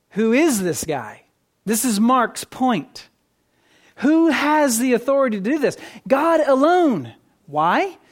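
forceful preacher, pitch climbing on key words; emphatic preaching. Real, not acted. Preacher arguing God alone forgives sins, emphatic preaching. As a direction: hammer the question, then answer it firmly.

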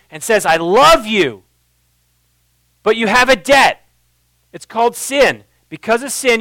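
loud, high, driving sermon voice; full-force preaching. Acted. Pastor rallying the congregation, preaching at full force. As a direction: preach it hard to the room, building and pounding.

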